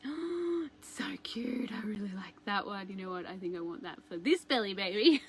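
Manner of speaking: mild retold whisper of delight; hushed delight. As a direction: breathe it out like you've fallen for it. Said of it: "And I'm like".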